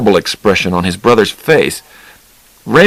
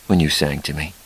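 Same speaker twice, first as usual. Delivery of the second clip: tender; 5 semitones lower, 9 dB quieter than usual. Acted. Charlie tender memory.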